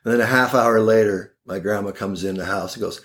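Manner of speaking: ordinary talk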